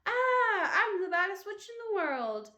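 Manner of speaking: high, loud shrieking witch cry; child's gleeful witch scream. A narrator's reading. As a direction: scream it as you run in. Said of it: "ran screaming onto the playground".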